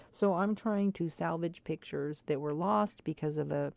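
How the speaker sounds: ordinary talk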